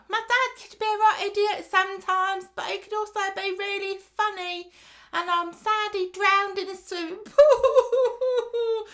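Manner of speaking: put-on very high piping kid voice, slowing into a sing-song at the end; child's voice. Orphan giving a speech about his dad, high childish sing-song. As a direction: recite it like a little boy at a memorial. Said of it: "So he's like".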